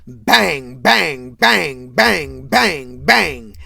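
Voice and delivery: very loud repeated bangs, evenly spaced; banging. Retold or imitated. Gerard Senior joking about making Junior, loud rhythmic bangs. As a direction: punch out each bang like a blow. Father's lewd joke acted as banging.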